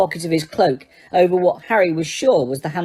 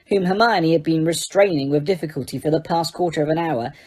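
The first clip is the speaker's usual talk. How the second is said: ordinary delivery, nothing marked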